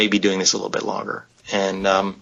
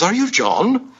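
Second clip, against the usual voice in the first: put-on British accent; 11 semitones higher, slower than usual. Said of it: "with his British accent".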